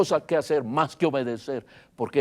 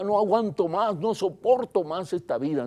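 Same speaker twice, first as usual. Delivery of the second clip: higher-pitched, retold cry of despair; desperate cry; 6 semitones higher than usual. Preacher voicing the possessed man's cries, desperate. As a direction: act out a tormented man's plea. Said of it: "con desesperación gritaba".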